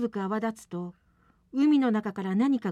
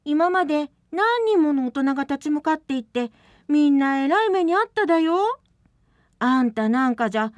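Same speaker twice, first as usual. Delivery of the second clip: firm scolding; 6 semitones higher, 6 dB louder than usual. Acted. Miho scolding Matsu.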